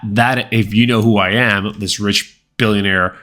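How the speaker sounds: ordinary talk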